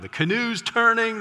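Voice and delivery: high, loud mock cry of warning; congregation yelling. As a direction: call it out to shout the preacher down. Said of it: "they start yelling".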